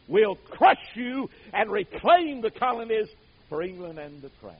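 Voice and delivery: rising and falling full-voiced preacher; preaching. Preacher telling revival history to the church, in full preaching voice. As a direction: preach it to the congregation with fire.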